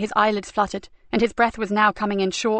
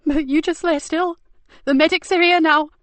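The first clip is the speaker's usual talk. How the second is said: tearful; 7 semitones higher, 4 dB louder than usual. A narrator's reading. Tearful, higher.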